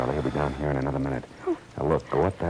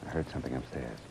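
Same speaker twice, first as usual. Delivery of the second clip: hushed; 4 semitones lower, 12 dB quieter than usual. Acted. Hiding, hears intruder.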